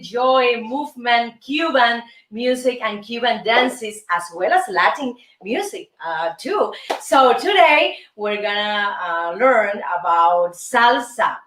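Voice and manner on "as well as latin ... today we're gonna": normal talking voice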